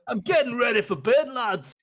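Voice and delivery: a disturbed dwarf yelling through the door; grumpy dwarf. Acted. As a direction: Doran roaring at the knock. Dwarf continues.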